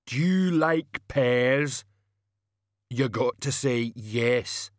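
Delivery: slow, odd hulking voice; rumbling brute. Acted. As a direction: menace like a slow giant. Banjo questioning Brown.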